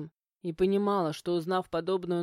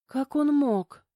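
higher, louder, slow stunned plaint; жалобный лепет; 6 semitones higher, 5 dB louder, slower than usual. A narrator's reading. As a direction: draw it out high, close to a sob. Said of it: "пролепетала она и, всхлипнув". (жалобно, протяжно, на грани слёз)

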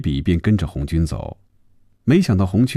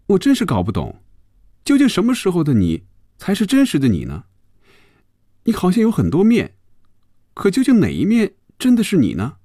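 put-on high-pitched perplexed young woman; Phoebe, puzzled and searching; 12 semitones higher than usual. Phoebe asks Hongjun which side is real, puzzled. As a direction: press the question in a high female voice.

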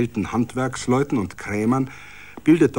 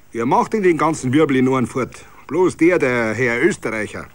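a lightly voiced Bavarian regular, raised; lightly voiced pub regular complaining; tavern grumbler; 3 semitones higher, 7 dB louder than usual. Villager in tavern talk.

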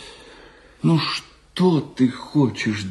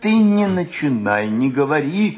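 agitated refusal; 5 semitones higher than usual. Acted. Agitated refusal to hear friend out.

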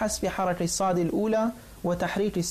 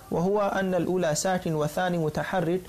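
normal talking voice

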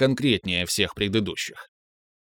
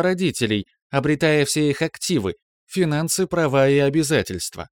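ship computer voice; 5 semitones higher than usual. Acted. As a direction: report evenly like a ship's AI. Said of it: "механический голос бортового компьютера продолжал".

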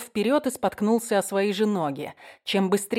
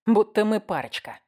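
plain reading voice

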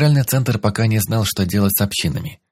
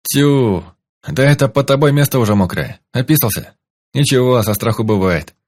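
loud jeering, higher; mocking; 5 semitones higher, 4 dB louder than usual. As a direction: jeer at the hostage. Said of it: "Продолжал стебаться Хорёк".

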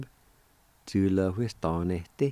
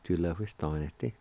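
"Julehuistanete" run together at normal pace, trailing softer; whole word, joined; 3 semitones lower, 5 dB quieter than usual. Shown as a demonstration. Teacher repeating the long word whole, joined-up. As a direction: say Julehuistanete in one smooth, softer go. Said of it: "Break it down something like that".

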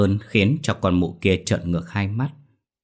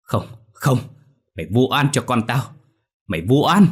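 higher, louder denial, peaking in a scream; 5 semitones higher, 5 dB louder than usual. Acted. Mother screaming denials at the ghost. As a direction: scream it back in panic. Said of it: "mụ gào thét".

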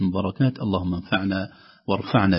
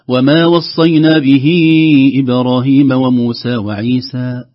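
louder, sustained chanting; Quran recitation; 3 semitones higher, 7 dB louder, slower than usual. Shown as a demonstration. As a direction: chant it as a fresh start of the verse. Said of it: "لو بدأنا بعده نقول". (تلاوة بابتداء جديد)

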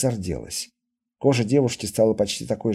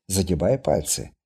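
ordinary delivery

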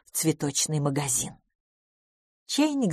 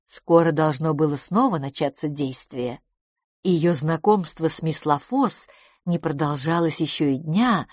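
usual speaking voice

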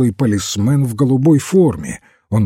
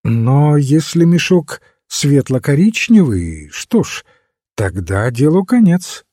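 lightly voiced priest, higher and emphatic; priest character; 5 semitones higher, 4 dB louder than usual. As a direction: state it firmly in a raised pitch. Priest sums up the case. (священник, веско, повышенным тоном)

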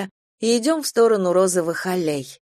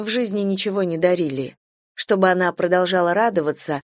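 ordinary delivery

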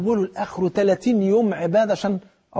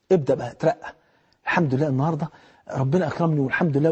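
normal talking voice

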